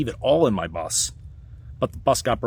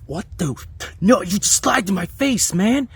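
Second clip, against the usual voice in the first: much higher, louder angry disbelief; indignant outburst; 11 semitones higher, 6 dB louder than usual. Retold or imitated. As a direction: explode at being lied to. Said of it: "it becomes a trigger".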